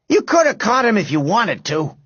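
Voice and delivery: high-pitched accusation; accusing. A narrator's reading. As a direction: blame a friend indignantly. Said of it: "accused Robert".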